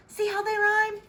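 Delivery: high, airy sing-song voice; sing-song princess. A narrator's reading. Princess charming her father, high sing-song. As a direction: float it up playfully.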